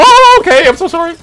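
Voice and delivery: very high, loud, yelped apology; panicked apology. Pretend. Gamer backing off from an upset rhino, high panicked apology. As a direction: yelp a hammed-up sorry.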